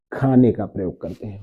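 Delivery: ordinary delivery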